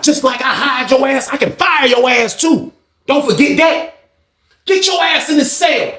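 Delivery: high, heated retold warning; threatening boss. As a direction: put him in his place. Retold Walter threatening his hired man.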